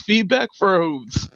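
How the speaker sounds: drawn-out, deliberate announcement; slow dramatic. Acted. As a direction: savour each word like a title. Repeats name drawn out.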